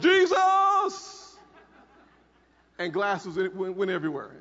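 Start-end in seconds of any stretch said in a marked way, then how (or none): 0.0-1.4 sudden high, loud shout; yelled Jesus. Retold or imitated. Crash victim crying out while rolling the car, loud shout. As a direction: cry out in the crash. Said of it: "I yelled".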